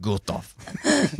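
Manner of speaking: Gustave said the German way, "ɡultɔf", heavy and guttural; mock German accent. Shown as a demonstration. Host tries the name on his cohosts in mock German. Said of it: "What if I say it like this?".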